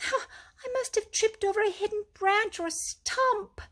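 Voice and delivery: high, whining misery with a catch; sorry for himself and hurting; miserable. A narrator's reading. Mole explains his fall to Rat, miserably. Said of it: "said the mole miserably".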